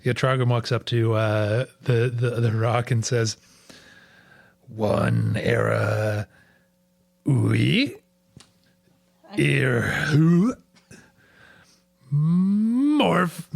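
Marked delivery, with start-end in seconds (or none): 4.7-13.5 slow, halting syllables read in reverse; backwards speech. Acted. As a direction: sound out "One era, ui, irhu, morph" slowly, word by word. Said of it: "but you say it backwards".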